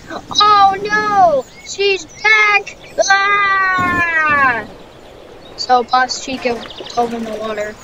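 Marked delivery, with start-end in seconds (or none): 0.1-2.8 high, loud alarmed cry; sudden fright, voice shooting up; panicked. Acted. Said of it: "And then she's like". 3.1-4.6 short high alarmed scream. Acted. Character sees returning enemy.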